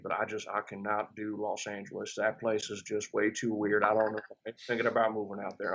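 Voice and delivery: lightly voiced Georgia accent; a hint of an old Southern relative from Georgia; imitating Southern granddad. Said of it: "he talks like he's from, like, Athens, Georgia".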